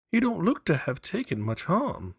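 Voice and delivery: high, polite wife's voice; polite old woman. Acted. Wife politely remarks on the visitor, in a high voice.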